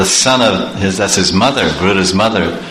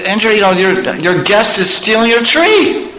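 high, urgent report of a theft; tattling gardener; 9 semitones higher than usual. Acted. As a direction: raise the pitch in mock alarm. Gardener phones Indra about theft.

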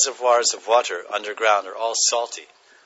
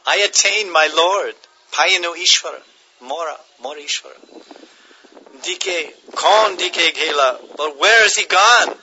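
higher and louder, climbing into anguished ecstasy; ecstatic longing Nimai; 5 semitones higher, 5 dB louder than usual. Acted. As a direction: cry out for the lost Lord, rising to a peak. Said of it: "Nimai Pandit said".

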